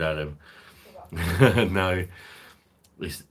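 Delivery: usual speaking voice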